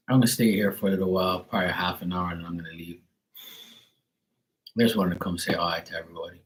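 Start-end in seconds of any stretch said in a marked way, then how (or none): 4.8-6.4 talking around a candy; speaking with candy in mouth; mouth full. Real, not acted. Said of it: "I have a candy in my mouth".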